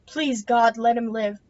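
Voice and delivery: plain reading voice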